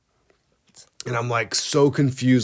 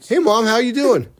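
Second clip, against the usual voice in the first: much higher bright greeting; cheery clueless self; 11 semitones higher than usual. Retold or imitated. Son calling mom again having forgotten, high cheery voice. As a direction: greet her as if for the first time.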